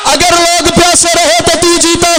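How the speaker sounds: प्यासे stressed, अगर लोग प्यासे रहे तो तू जीता proclaimed loud; full-force declaiming. Shown as a demonstration. Preacher proclaiming Husain's words to the majlis, ringing out. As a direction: proclaim the line to the whole hall. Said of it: "ऐसे नहीं, ऐसे नहीं".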